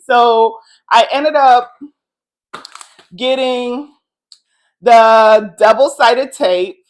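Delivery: buzzing with excitement, nearly yelling; excited loud talk. Real, not acted. Vlogger excited to hang the art, talking loud. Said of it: "I was yelling over there".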